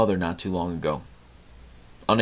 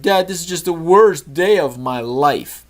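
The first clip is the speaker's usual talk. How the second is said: put-on high, slow, whiny kid's complaint; whiny son; 6 semitones higher, 5 dB louder than usual. Son complains to dad in the car, whiny. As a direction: drag it out like a sulking boy.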